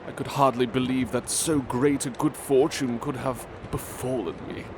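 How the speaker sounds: ordinary delivery, nothing marked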